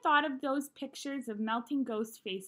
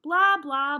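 loud, punched blah blah like a droning teacher; mock Charlie Brown teacher drone, loud; 3 semitones higher, 10 dB louder than usual. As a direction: bark the blahs out as a mock teacher. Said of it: "the way the grown-ups talk in Charlie Brown movies".

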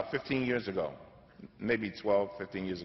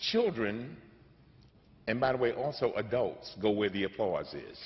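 normal talking voice

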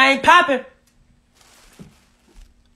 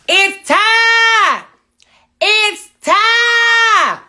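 drawn-out ring announcer call, higher and louder; hype announcer shout; 9 semitones higher, 9 dB louder, much slower than usual. Acted. Host kicking off his livestream to viewers, a drawn-out hype shout. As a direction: bellow it like the show is starting.

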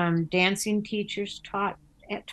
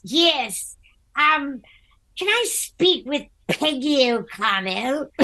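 higher, lightly put-on caller's voice; 7 semitones higher, 5 dB louder, slower than usual. Retelling a stranger's phone call, lightly put-on voice. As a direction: play the formal caller on the phone. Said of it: "this voice goes".

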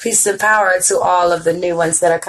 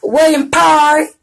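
higher, louder light Southern drawl on "William Perry"; 8 semitones higher, 4 dB louder than usual. Retold or imitated. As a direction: stretch out "William Perry" in a Southern accent, imitating the king. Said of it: "he has a little southern drawl".

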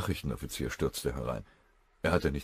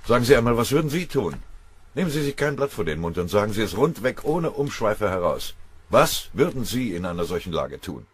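loud, higher and urgent general; commanding general; 6 semitones higher, 10 dB louder than usual. Acted. General tells his chief of staff the flank is in danger, loudly. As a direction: hammer out the worries about the flank.

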